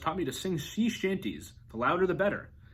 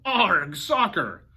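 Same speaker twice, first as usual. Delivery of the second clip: loud, higher pirate arg; pirate yell; 6 semitones higher, 8 dB louder, much slower than usual. A narrator's reading. Braidbeard kicks the soccer ball, loud yell. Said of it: "kicked the ball and yelled".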